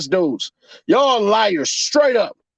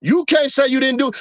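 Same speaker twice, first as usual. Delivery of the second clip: ordinary talk, nothing marked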